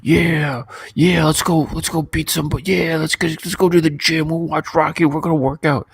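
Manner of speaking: imitating excited friends leaving the movie; pumped-up buddies. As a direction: play the amped-up teens after an action film. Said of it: "me and my boys would come out of it and be like".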